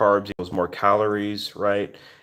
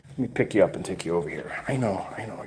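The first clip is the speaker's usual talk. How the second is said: soft, hushed comforting; 5 dB quieter, faster than usual. Real, not acted. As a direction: gently reassure a frightened pet. Picking up the scared cat.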